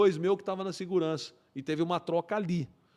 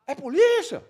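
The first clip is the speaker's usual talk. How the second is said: high, loud shouted query; acted questioning shout; 8 semitones higher, 6 dB louder than usual. Uniformed officer shouting back to plainclothes cop, acted questioning yell. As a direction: yell back to check who it is. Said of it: "Aí ele".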